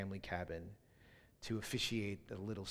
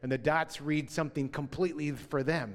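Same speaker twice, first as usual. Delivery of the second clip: ordinary talk, nothing marked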